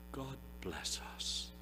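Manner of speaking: ordinary delivery, nothing marked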